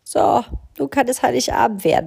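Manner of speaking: satisfied crow. A narrator's reading.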